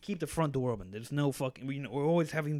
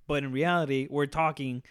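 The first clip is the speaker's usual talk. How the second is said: ordinary talk, nothing marked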